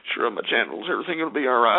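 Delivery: low, soft seductive Southern croon; Bill Clinton. Retold or imitated. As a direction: sweet-talk like a smooth Southern charmer. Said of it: "gentle Southern charm".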